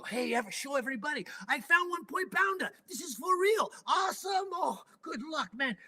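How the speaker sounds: high, bright boasting to the crowd; high-pitched thrilled show-off; excited bragging. Retold or imitated. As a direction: show it off like a kid with a prize. Showing off find to everybody.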